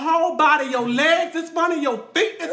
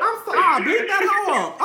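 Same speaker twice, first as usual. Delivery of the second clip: ordinary talk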